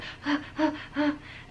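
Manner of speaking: imitated high, breathy panting; out-of-breath gasping. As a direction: pant as if winded from eating. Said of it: "like, out of breath".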